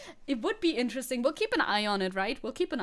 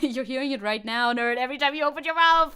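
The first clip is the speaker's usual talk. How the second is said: loud, laughing comeback that speeds up; mock-heckle, getting louder; teasing loud jab; 8 dB louder than usual. Real, not acted. Streamer ribbing chat about the accent, loud teasing.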